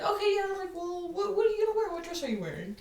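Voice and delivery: mock eager friend; high-pitched friend voice. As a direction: play the curious friend, voice up high. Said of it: "She's like".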